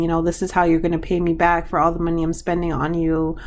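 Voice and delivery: ordinary talk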